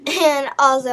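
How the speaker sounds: ordinary talk, nothing marked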